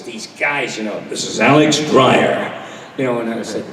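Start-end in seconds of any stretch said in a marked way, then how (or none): 1.1-2.5 slow, loud, booming DJ announcer; old-school disc jockey intro; big radio announcer voice. Retold or imitated. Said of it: "these big voices".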